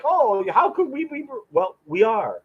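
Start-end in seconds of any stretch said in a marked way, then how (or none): 0.0-1.3 a high, squeaky mock of disbelief; mocking incredulous creationist. Host mocks creationists scoffing at evolution, high mock incredulity.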